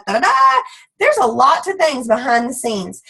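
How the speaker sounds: ordinary delivery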